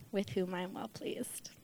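breathy hushed whisper. Real, not acted. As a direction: whisper the blessing.

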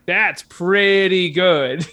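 drawn-out, slow and flat; slow deadpan. Retold or imitated. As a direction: stretch it out, unimpressed. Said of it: "And I was like".

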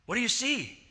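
rising alarm in the question. Retold or imitated. Husband alarmed at night.

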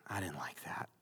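breathy whisper of a private doubt; whispered thought. Retold or imitated. Preacher voicing a listener's inner doubt, whispered. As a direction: whisper it like a thought you would not say aloud.

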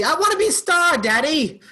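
high, theatrical mid-Atlantic diva whine. Acted. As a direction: belt it like a Broadway brat, mid-Atlantic. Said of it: "Kinda going to a mid-Atlantic voice there".

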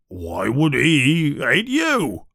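slow and evasive; strange way. A narrator's reading. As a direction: say it oddly, dodging the question. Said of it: "Hagrid says this in a strange way".

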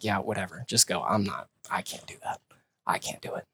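quietly voiced Naomi, low and soft; mild hushed refusal. Naomi softly refusing Ruth's plan, hushed. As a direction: murmur it like a weary refusal.

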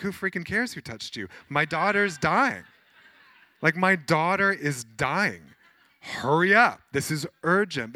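near-shouting impatience at the delay; frantic father. Retold or imitated. Father snapping at Jesus to hurry, loud and frantic. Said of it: "You'd be like".

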